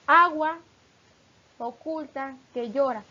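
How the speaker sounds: "agua" punched loud, rest falls back; loud opening on "agua" showing the vowel join; verse reading with stress. Shown as a demonstration. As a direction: lean hard on "agua" then ease into "oculta que llora". Teacher reads sinalefa example.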